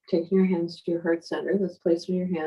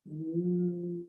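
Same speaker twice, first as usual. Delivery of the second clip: soft fading bee hum; bumblebee humming; 9 dB quieter than usual. Shown as a demonstration. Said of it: "making a humming sound like a bumblebee".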